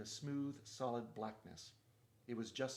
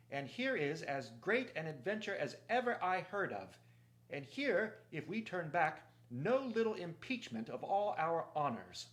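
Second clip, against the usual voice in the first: proud, raised oratory; clear-voiced Reepicheep; 5 semitones higher, 5 dB louder than usual. A narrator's reading. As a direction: shame the company with a ringing speech. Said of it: "the clear voice of Reepicheep".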